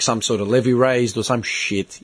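ordinary delivery

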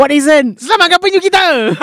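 high shouted rally cry, laughing; mock rally shout. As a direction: yell it like a campaign slogan.

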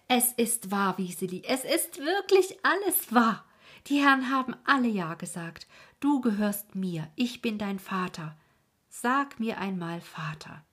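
high, loud triumphant cry of joy; a father overjoyed, announcing the adoption. A narrator's reading. Andres tells Wiseli he is her father, triumphant. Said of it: "rief er mit triumphierender Stimme".

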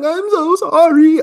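high, slow, wailing sob of an apology; imitating a girlfriend's drawn-out tearful sorry; ugly crying. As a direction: ham up her ugly crying. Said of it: "ugly crying".

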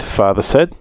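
usual speaking voice

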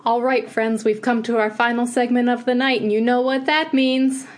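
rising, upbeat announcer intro; show host voice. Acted. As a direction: hype up the segment.

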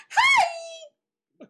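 put-on high, loud, bright-eyed girl's greeting; mock the girlfriend's perky hello, way up high; shrill mock greeting; chirpy loud Hi. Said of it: "She said it like that?".

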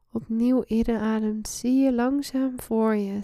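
ordinary delivery, nothing marked

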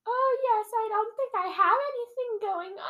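very high, affected delight; mock-eager sweetheart, close to laughing; gushing princess voice. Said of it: "Your accents are just".

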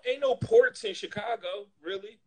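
usual speaking voice

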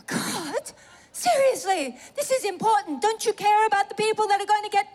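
high-pitched, hammed-up protest to God; exasperated pleading. Woman sat challenging God about her call, exasperated pleading. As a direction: wail the complaint in mock indignation.

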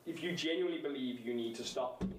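ordinary delivery

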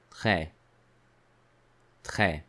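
Très said with an open è; teacher models Très; French è demo. Shown as a demonstration. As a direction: hold the open vowel in Très. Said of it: "Notice the word très".

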